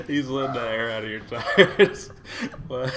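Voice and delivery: loud, high, slow through laughter; laughing. Real, not acted. Repeating the video's punchline to co-host, helplessly laughing. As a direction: crack up while repeating the line. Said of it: "say that without laugh-, like, stopping and laughing".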